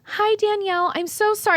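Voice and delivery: high-pitched timid apology; mock apologetic. Student rehearsing a price script on Danielle, mock apologetic. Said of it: "why are you apologizing".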